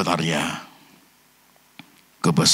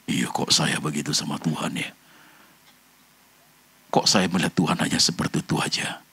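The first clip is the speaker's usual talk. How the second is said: hushed sermon reflection; 6 semitones lower than usual. Acted. Reflective hushed preaching passage. (khotbah lirih dan merenung)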